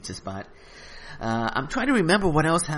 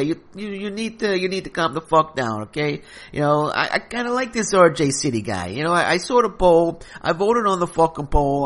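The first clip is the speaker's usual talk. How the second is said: loud, higher hotheaded mobster voice; Carmine the caller; 4 semitones higher, 3 dB louder than usual. Acted. Mobster caller telling the host to calm down, loud. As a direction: shout him down like an angry wiseguy.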